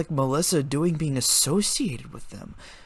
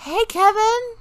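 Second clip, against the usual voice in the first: sheepishly; 5 dB louder, slower than usual. A narrator's reading. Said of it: "Melissa said sheepishly".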